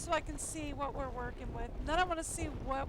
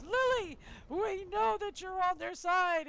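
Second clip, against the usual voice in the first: much higher and louder, a big theatrical reveal; mock dramatic accusation; 6 semitones higher, 7 dB louder than usual. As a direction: play the soap-opera confrontation. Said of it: "everybody goes".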